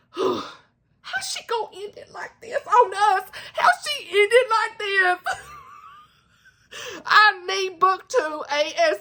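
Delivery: high, slow, crying complaint; wailing playfully at the author; mock crying plea. Real, not acted. Vlogger begging for book two, high crying wail. Upset at the ending.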